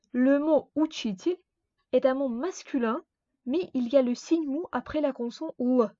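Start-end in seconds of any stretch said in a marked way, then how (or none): none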